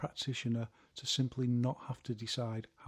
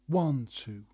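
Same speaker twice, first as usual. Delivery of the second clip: one punched louder, two lighter; stress on one; 6 dB louder than usual. Shown as a demonstration. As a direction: hit the word one hard. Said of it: "put more emphasis on the jab".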